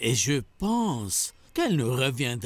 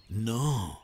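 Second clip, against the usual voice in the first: usual speaking voice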